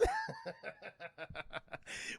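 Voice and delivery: high-pitched giggle; high laugh. Real, not acted. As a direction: crack up. Laughing at himself.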